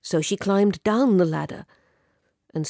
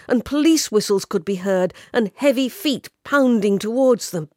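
ordinary delivery

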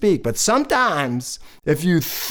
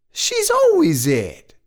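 high, long-stretched exclamation; exaggerated aside; 12 semitones higher, slower than usual. Storyteller comments on hide and seek, exaggerated aside. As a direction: swoop up high and drag it out. Comic aside about the girl.

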